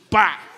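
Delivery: loud, sharp, high bang; door slam sound. Retold or imitated. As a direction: smack it like a slamming door. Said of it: "barulho da porta".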